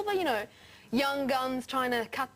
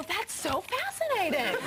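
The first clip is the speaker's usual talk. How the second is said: slow, drawn-out fake amazement; mock American fascination; 8 semitones higher than usual. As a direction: gush like a wide-eyed American tourist. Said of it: "and they go".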